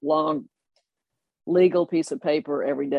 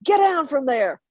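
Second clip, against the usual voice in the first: angry colonel; 5 semitones higher than usual. Retold or imitated. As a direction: bark at the kids on the roof like an outraged colonel. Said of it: "Colonel Poole is like".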